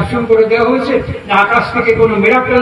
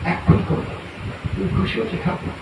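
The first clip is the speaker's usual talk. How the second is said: whispered plea; 2 semitones lower, 9 dB quieter, faster than usual. Acted. Whispered question to deity.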